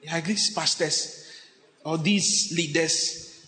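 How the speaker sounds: slow, dragged-out grumble; grumbling complaint. Acted. Member complaining about bossy leaders, slow grumble. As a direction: drag the words out in weary complaint.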